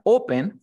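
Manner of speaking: Open, loud first syllable; stress at the start. Shown as a demonstration. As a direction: punch the O of Open. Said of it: "al inicio".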